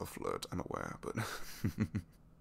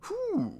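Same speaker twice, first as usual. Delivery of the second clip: high, loud whew; 8 dB louder than usual. Acted. Recovering from surprise kiss.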